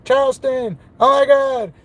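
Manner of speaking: imitating a giddy fan, high and squeaky; excited little kid. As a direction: gush like a kid spotting his hero. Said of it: "like a little kid trying to jump on him".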